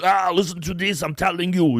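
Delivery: high, strained mock Austrian accent; hammed-up Austrian-accented yell; Arnold. Co-host getting worked up mid-rant, done as a mock Austrian-accented impression. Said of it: "does his Arnold".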